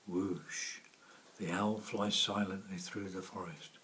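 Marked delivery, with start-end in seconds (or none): none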